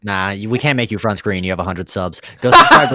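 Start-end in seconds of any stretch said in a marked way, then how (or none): 2.4-2.9 much higher, laughing; teasing his friend while laughing, high and loud. Real, not acted. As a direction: burst out laughing. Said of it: "bullying friend, laughing".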